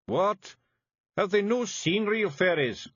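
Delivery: high, thick Scots pixie voice; small tough Scots fairy breaking bad news; Scottish Feegle voice. A narrator's reading. Feegle indignant.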